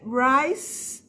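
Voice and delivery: Rice slowly, ending on a clean S. Shown as a demonstration. Teacher showing students the final S in Rice, slowly. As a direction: hiss the S at the end of Rice. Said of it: "um som de S no final".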